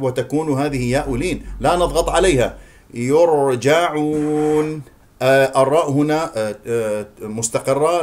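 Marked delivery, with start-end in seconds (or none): 2.9-4.8 slow يرجعون, holding the R without a bounce; still R. Shown as a demonstration. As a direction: draw out يرجعون with a steady unvowelled ر. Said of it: "لا تقلقلها أو تحركها". (يرجعون براء ساكنة مستقرة)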